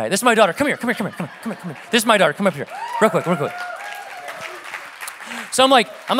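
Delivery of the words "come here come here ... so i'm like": ordinary talk, nothing marked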